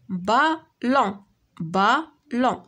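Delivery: slow بالون in two beats, a gap between the syllables. Shown as a demonstration. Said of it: "دو سيلاب". (بالون مقطعة على جوج سيلاب بشوية)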